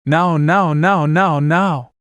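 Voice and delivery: high, rhythmic urging; repeated mild shout. A narrator's reading. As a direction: hurry them with insistent light shouts. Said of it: "Shin shouted".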